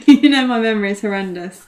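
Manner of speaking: words bubbling out on a laugh. Real, not acted. Admitting a bad memory to a co-host, laughing.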